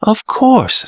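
high, cheery reply; cheerful. A narrator's reading. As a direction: answer brightly, voice lifted high. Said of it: "said the Blue Prince cheerfully".

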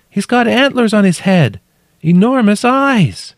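very high voice calling from below. A narrator's reading. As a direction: call up the details of a vision. Trapped woman calling up vision.

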